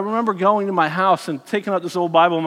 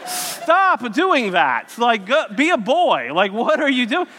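high, slow, laughing disbelief; half-laughing, pleading with the boy to stop; exasperated laughing dad; 6 semitones higher, slower than usual. Retold or imitated. Father to his scripture-quoting son, laughing in slow, high disbelief. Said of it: "And I'm like".

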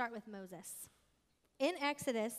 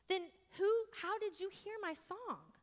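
astonished king; 8 semitones higher, slower than usual. Acted.

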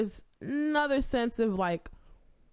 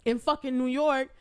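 normal talking voice

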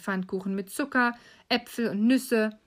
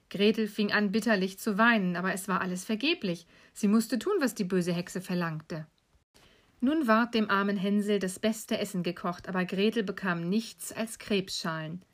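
ordinary delivery

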